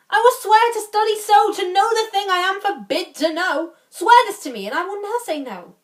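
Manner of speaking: high, theatrical mock vow; witty lord striking a bargain. Playing Barone, mocking the oath.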